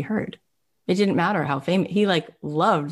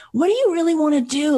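high, eager question; excited; 11 semitones higher, 4 dB louder, faster than usual. Retold or imitated. Brian asking Ron his dream, high and eager. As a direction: lean in keenly.

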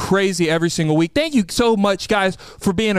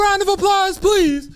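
hype emcee; 11 semitones higher than usual. Acted. Calling for applause.

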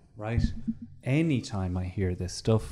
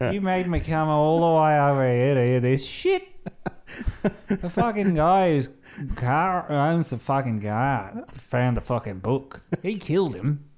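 louder, higher mock grumbling; mock annoyed rant; 4 semitones higher, 4 dB louder than usual. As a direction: grumble like a put-out bloke. Annoyed Australian after long trip.

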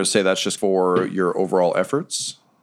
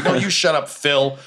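higher-pitched mock retort; mildly testy, pitched-up comeback; bickering bat; 6 semitones higher than usual. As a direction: snap back in light squabbling. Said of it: "It's like".

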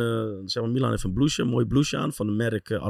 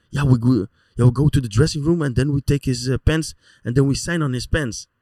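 eager broken English; 4 semitones higher, 5 dB louder than usual. Retold or imitated. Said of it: "Zlatan plotting".

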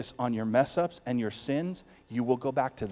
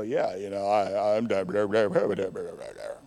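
lower, dismissive mock of bragging; lazy, lowered smug brag; smug mock boast; 7 semitones lower than usual. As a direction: sneer it like a self-made man. Mocking a self-satisfied boaster.

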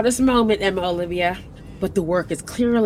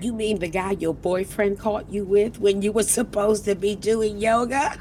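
ordinary talk

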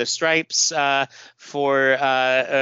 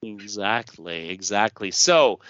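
ordinary delivery

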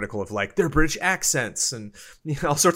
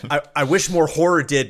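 ordinary talk, nothing marked

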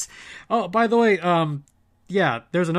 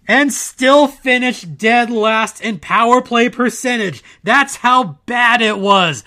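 loud, incredulous rant; exasperated rant; 4 semitones higher, 5 dB louder than usual. Real, not acted. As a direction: build up and vent about it. Ranting about worst power play.

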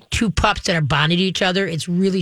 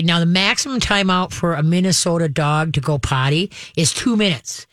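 normal talking voice

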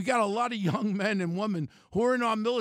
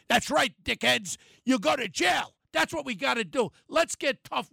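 angry shout; 2 semitones higher than usual. Real, not acted. Radio host yelling at gun offenders, angry shout. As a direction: bark it at the criminals. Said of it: "I'm getting so angry here".

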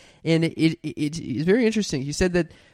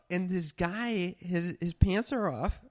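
ordinary talk, nothing marked

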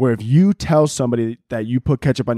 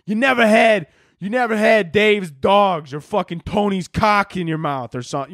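mock Chicago listener; 5 semitones higher than usual. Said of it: "being like".

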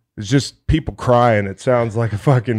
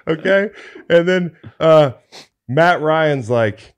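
normal talking voice

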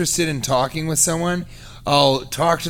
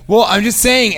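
high, louder mock-defensive shrug of a line; defensive retort; 7 semitones higher, 5 dB louder than usual. Woman at the market brushing him off, mock-defensive. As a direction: toss it off like she's not backing down.

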